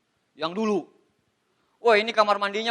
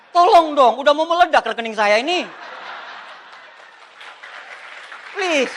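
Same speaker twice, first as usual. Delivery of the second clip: loud, high mock plea; comically overdone begging; mock pleading; 5 semitones higher, 7 dB louder than usual. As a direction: beg in playful exasperation, louder and higher. Mock plea about too much money.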